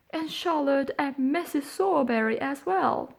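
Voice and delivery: put-on high whining crybaby boy; fake tearful wail. Noah running to the beadle faking terror, high tearful wail. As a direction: put on a frightened sob story in a high wail. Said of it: "Noah cried".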